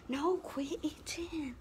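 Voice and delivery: hushed, slow telling-off; whispered scolding. Real, not acted. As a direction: whisper it sharply at the dog.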